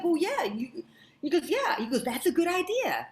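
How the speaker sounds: high, excited imitation of a man agreeing; keen agreement. Herbie takes up her idea keenly.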